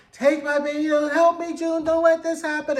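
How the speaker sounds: imitated Serena screaming at June not to let it happen; mock panicked scream. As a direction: replay the mother's desperate cry. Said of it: "she's screaming".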